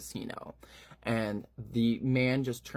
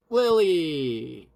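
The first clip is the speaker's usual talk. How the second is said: "Lily!" called louder with a long fall; calling out; 5 semitones higher, 7 dB louder than usual. Acted. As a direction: shout "Lily!" across the room. C calls Lily to the stage.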